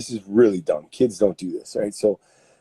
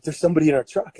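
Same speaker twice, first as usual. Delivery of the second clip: alarmed wife; 6 semitones higher, 5 dB louder, faster than usual. Retold or imitated. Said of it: "she's like".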